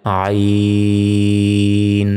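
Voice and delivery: আইন held long, closed on ন; drawn-out stop on ain. Shown as a demonstration. Teacher demonstrates the stop on ain, long chant. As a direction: stretch আইন like a reciter. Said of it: "এখানে থামলে".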